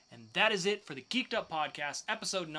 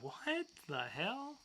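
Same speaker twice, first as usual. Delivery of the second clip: surprised; 9 semitones higher, much slower than usual. Real, not acted. Reacting to strange story.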